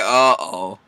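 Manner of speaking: low, soft mock dread; foreboding uh-oh. Everybody looking around the table, foreboding. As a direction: drop it low and quiet.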